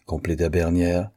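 plain reading voice

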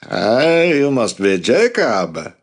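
put-on slow, high French-accented man; thick French accent. A narrator's reading. Maury greeting Jacob, in a thick French accent. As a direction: let the French accent roll through each word. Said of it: "He spoke with a thick French accent".

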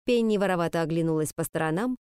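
usual speaking voice

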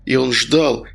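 ordinary delivery, nothing marked

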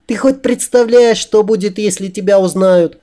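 high-pitched anxious female voice; worried woman. A narrator's reading. She warns him he may be recognised, high and anxious. Worried heroine scolds her friend.

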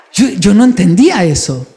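ordinary talk, nothing marked

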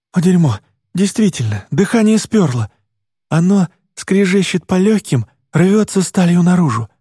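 high, breathless panic; panicked gasping. Acted. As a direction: panic as your lungs seize. Asthma attack, panicking.

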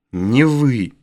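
usual speaking voice

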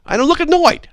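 high, loud sing-song denial; playful high-pitched protest; mock innocent. Real, not acted. Denying annoyance.

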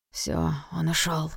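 slow hushed whisper. Acted. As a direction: breathe it out softly and slowly. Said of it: "прошептал Немо".

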